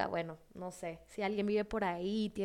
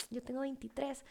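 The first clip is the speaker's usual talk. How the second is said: much softer, secretive reply; retold hushed confiding; 3 semitones higher, 9 dB quieter than usual. Guatemalan girl confiding her age back, retold hush.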